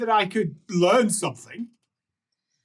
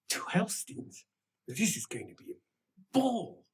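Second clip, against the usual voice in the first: soft, muted guard's murmur; conspiratorial aside; 3 semitones higher, 10 dB quieter than usual. Acted. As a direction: confer quietly with your fellow guard. Guard confers aside with his twin.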